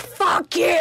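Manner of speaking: high, cursing outburst; mock outburst. Hearing husband's news of a death, high outburst. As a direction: fling it back in disbelief. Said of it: "I was like".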